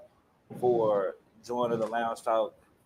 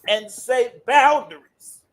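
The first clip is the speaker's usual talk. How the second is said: preacher call; 13 semitones higher, 11 dB louder, slower than usual. Shown as a demonstration. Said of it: "Turn to your neighbor and say".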